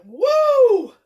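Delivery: high whooping "woo"; a quick high party whoop; mock cheer. Streamer whoops after joking he's making a killing, mock cheer.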